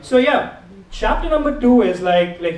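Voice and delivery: usual speaking voice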